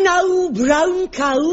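"now, brown cow" drawn out slowly, vowels held open; careful open vowels on "brown cow"; slow elocution drill. Shown as a demonstration. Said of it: "repeat it after me".

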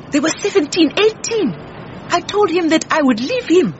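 upset outburst. Acted. Wife confessing her husband chased young girls, upset. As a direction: pour out an old grievance, pitch climbing.

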